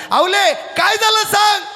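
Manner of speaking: very high, stretched-out question; urgent plea to wife. Acted. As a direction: cry out slowly, begging to know. Tukoba आवलीला विचारतात. (कळवळून, चढ्या आवाजात विचारत)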